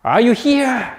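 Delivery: desperate cry to God. Retold or imitated. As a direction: cry out to God in despair, voice up high. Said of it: "he cries out like".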